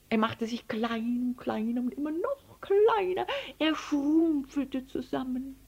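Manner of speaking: shrinking voice. A narrator's reading. Narrator showing the elephant getting smaller, shrinking voice. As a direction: let the voice shrink upward with him.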